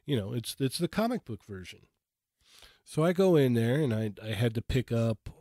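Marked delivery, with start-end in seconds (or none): 0.9-1.8 pointed stress on "comic book version"; emphasis on comic book version. Shown as a demonstration. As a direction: underline "comic book version" as the key detail. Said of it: "I'm gonna emphasize the word".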